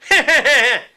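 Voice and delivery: high loud witch cackle. Shown as a demonstration. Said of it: "All right, try that".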